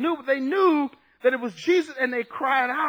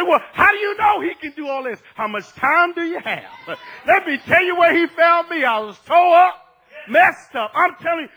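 loud, raised preaching voice; fervent testimony; 7 semitones higher, 9 dB louder than usual. Acted. Believer telling where Jesus found him, fervent testimony. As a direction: testify with forceful, punched phrases.

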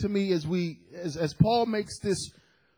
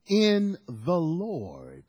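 stretched slowly; slow emphasis; much slower than usual. Real, not acted. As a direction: let each word land slowly. Preacher stresses scripture.

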